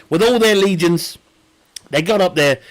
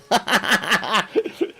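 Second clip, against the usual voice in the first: real laugh; 3 semitones higher, 4 dB quieter than usual. Real, not acted. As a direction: crack up at your own joke. Laughs at own Romans joke.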